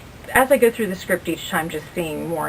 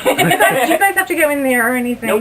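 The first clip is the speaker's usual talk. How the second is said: laughing question; 9 semitones higher, 9 dB louder, faster than usual. Real, not acted. Asks while still laughing.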